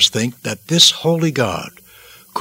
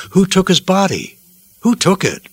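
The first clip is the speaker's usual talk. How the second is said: a high, sharp demand on "Who"; women demanding to know who took the body; 7 semitones higher than usual. Acted. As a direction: insist on an answer. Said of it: "they demanded to know".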